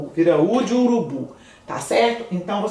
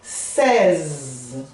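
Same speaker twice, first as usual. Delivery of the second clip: Vou falar seize very slowly, lower; 5 semitones lower, much slower than usual. Shown as a demonstration. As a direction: drag out seize. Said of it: "o que que eu vou falar?". (fala seize bem devagar)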